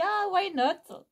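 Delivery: high-pitched, drawn-out playful musing; why-not reaction. Retold or imitated. Shopper recalling her first thought on seeing the bag, playfully tempted. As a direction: recall giving in, slowly and high. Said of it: "je me suis dit".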